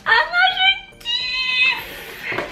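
very high, drawn-out shout of joy; excited squeal. Real, not acted. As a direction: squeal it out with delight. Thrilled opening a gift. (cri de joie aigu et traînant)

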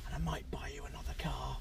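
quick conspiratorial whisper. Real, not acted. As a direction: whisper it like a secret treat. Secret treat offer.

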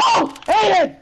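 high urgent yell; yelling across the room. Real, not acted. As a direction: shout for him to hand it over. Calling a teammate in game.

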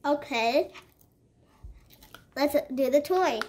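talking through a mouthful of chocolate; chocolate still in the mouth; mouth full. Real, not acted. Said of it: "Sorry if I'm talking weird".

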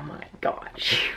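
slow, soft whispered amazement; awed whisper. Real, not acted. Collector marvels at the astronaut Freddy, awed whisper. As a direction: whisper it in happy disbelief. Overjoyed while others are sleeping.

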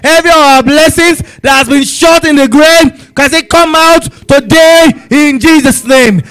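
rising, urgent prayer, pressing each line; fervent prayer. Acted. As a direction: pray it out with full force to God. Fervent prayer leading congregation.

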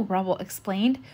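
ordinary delivery

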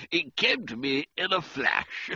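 usual speaking voice

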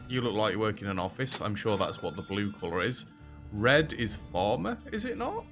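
ordinary talk

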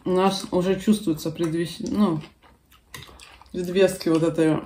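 speaking between bites; talking while chewing. Real, not acted. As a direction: mouth partly full of food. Seen 2: Left woman took spoonful at -2, lips closed chewing while talking.

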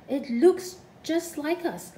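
slow, wondering awe; marveling. A narrator's reading. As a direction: marvel at it slowly. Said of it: "marveled Cuddlepie".

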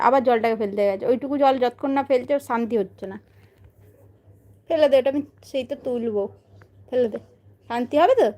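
usual speaking voice

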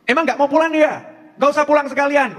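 high-pitched mock scolding; imitated wife's yelling. Istri teriak ke suami yang telat pulang, mock angry. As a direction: act out a fed-up wife telling off her husband. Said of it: "teriakan-teriakan begitu manfaat nggak?".

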